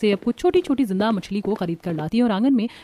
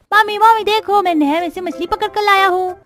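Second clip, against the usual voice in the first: put-on much higher child's voice, eager; excited little boy; 11 semitones higher, 4 dB louder than usual. Boy Monu showing mother his fish, excited child voice. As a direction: call out proudly like a small kid.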